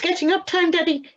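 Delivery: high, loud wake-up call from a little owl; excited shout. Acted. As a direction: yell it to rouse a sleeping dad. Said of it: "he shouted".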